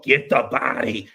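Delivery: slow, drawn-out church chant; lightly voiced preacher calling out; mock gospel preacher. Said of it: "She talked about".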